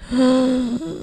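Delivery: sharp high gasp of disbelief; mock gasp of outrage; shocked gasp. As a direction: gasp as if stunned. Shocked at relatives' excuse.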